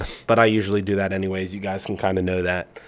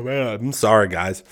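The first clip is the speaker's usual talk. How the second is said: ordinary delivery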